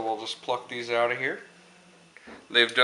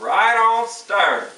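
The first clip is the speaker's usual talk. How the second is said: hammed-up cheer; 11 semitones higher, 11 dB louder, much slower than usual. Hammed-up cooking show cheer.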